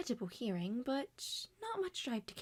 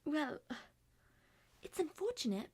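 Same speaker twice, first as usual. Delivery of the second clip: ordinary delivery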